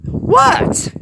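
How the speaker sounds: very high, loud indignant what; defensive. Acted. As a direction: squeak out in offended surprise. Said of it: "Joy questioned defensively".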